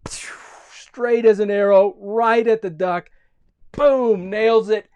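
high, loud, stretched-out sound-effect storytelling; dramatic action narration. A narrator's reading. Telling of Johnny's slingshot shot, loud dramatic excitement. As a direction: punch it up like a direct hit.

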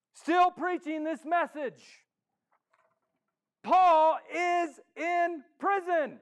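high, loud, emphatic sermon delivery; full-force preaching. Acted. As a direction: proclaim it to the whole room, hammering key words. Preaches Paul's plight at full force.

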